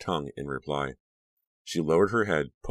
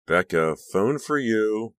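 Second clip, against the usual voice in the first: mother calling upstairs, louder, drawn out; raised-voice summons to someone upstairs; 6 semitones higher, 5 dB louder, much slower than usual. A narrator's reading. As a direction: call it up the stairs, pitch lifting on the end. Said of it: "her mother called up".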